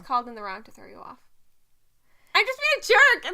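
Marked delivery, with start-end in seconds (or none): 2.3-3.3 high, loud blurted admission; teasing confession. Real, not acted. Admitting to co-host the nitpick was teasing, blurted. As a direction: burst out with it, much higher and louder.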